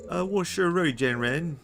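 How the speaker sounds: a second, bolder try at Mandarin in a Swedish accent; Chinese in a Swedish accent. Shown as a demonstration. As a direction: belt out "wo shi rui jian ren" like a proud Swede. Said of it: "How would I do Chinese in a Swedish accent?".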